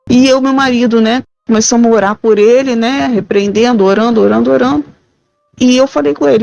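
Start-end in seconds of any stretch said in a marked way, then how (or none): none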